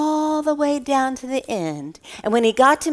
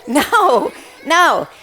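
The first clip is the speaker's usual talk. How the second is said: laughing no; 6 semitones higher, much slower than usual. Real, not acted. Child's silly McDonald's answer.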